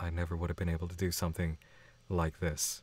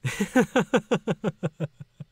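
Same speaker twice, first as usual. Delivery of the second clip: high cackling burst of laughter; high shrieking laugh; 12 semitones higher, 7 dB louder than usual. Real, not acted. Streamer shrieks with laughter at a fan's crude joke.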